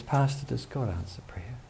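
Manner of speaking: tearful hushed whisper; whispered question. Retold or imitated. Crying lady asking the pastor about prayer, in a whisper. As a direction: whisper it through tears.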